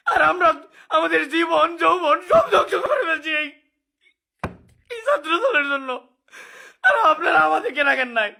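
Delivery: sobbing through the words; tearful broken complaint; snotty crying. Real, not acted.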